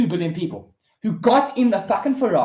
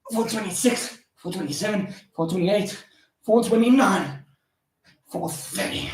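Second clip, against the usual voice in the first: counting through exertion. Real, not acted. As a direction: count out reps under effort. Counting while doing push-ups.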